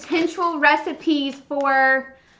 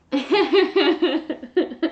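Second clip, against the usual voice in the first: laughing awesome; 3 semitones lower, much slower than usual. Real, not acted. Drawn out laughing.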